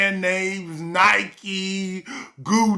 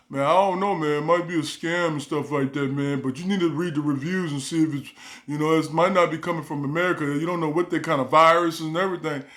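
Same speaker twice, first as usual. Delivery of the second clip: a hint of a worried older brother, hedging; lightly voiced cautious brother; 5 semitones lower, faster than usual. Brother warns on the phone about a scam, low and doubtful.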